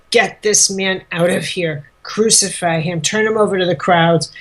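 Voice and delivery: ordinary talk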